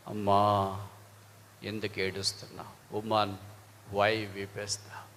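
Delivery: low, soft, very slow question; gentle words of Jesus. Acted. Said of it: "దాంట్లో మొదటి మాట".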